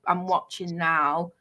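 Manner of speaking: ordinary delivery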